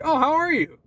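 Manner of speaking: high, bright mock coworker greeting; chirpy greeting. Imitating a coworker greeting him at work, high and chirpy. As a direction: chirp it like an overly cheerful coworker.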